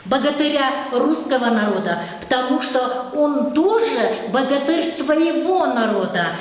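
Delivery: normal talking voice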